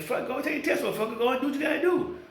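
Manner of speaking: high, brash, quickening dare; mock bold challenge. Man daring his woman to get the test, high and brash. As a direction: fire it off like a man with nothing to hide. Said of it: "you should be like".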